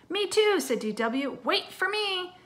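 put-on high, eager little-kid call; a small sister chasing after her brother; little girl voice. D W wanting to swim too, high and eager.

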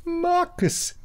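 high, far-off call of a name; high mock call. Podcasters mimic the film's eerie call. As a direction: cry out the name like a ghost.

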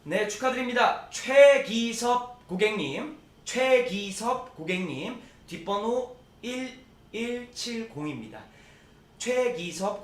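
loud, slow, stretched winner names; drawn-out winner call. Acted. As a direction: call the winner out slowly to everyone.

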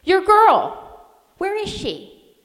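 high, loud, gruff sailor's command; old sailor barking fierce orders in a storm. A narrator's reading. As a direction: order her with fierce urgency. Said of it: "he ordered with a fierceness".

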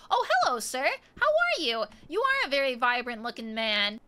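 overly cheerful hello to a stranger in the alley; sing-song greeting. Acted. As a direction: greet him with exaggerated sweetness. Exaggerated friendly greeting to stranger.

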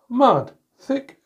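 usual speaking voice

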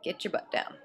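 ordinary delivery

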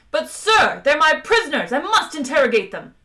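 loud, high, frustrated insistence; high-pitched, shouted exasperation; indignant protest. A narrator's reading. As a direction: plead angrily with your commanding officer. Frustrated officer protests to captain.

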